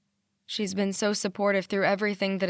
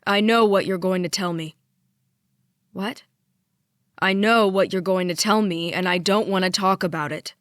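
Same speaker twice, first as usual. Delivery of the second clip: hard, louder, clipped warning; curt and cold; 5 dB louder than usual. A narrator's reading. Father shutting down his daughter on the phone, hard and loud. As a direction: shut her down before she speaks.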